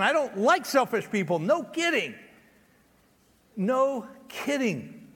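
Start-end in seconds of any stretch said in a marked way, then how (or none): 1.4-2.1 emphatic. Shown as a demonstration. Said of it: "repeated answer". 3.6-5.1 No kidding said slowly and dryly; slow, drawn out. Shown as a demonstration. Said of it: "repeated answer, slowed".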